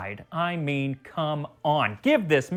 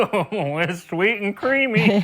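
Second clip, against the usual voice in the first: slower, strained as the booze hits; choking a little on the kick; booze hitting; 3 semitones higher, 6 dB louder, slower than usual. Retold or imitated. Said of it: "And then it's like".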